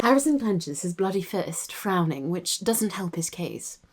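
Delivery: soft, measured RP narration; RP accent. Shown as a demonstration. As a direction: read it quietly in an RP English accent. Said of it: "[name] does the R P accent".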